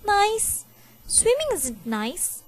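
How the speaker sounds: put-on high indignant child retort; boy snapping at his mom; angry. A narrator's reading. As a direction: throw the word back at her, offended.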